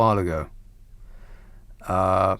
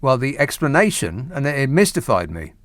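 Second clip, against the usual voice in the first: usual speaking voice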